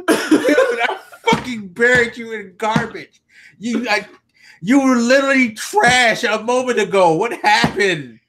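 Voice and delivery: high-pitched, incredulous protest through laughter; mock disbelief. Host plays the man who trashed the wrestler, incredulous mock outrage.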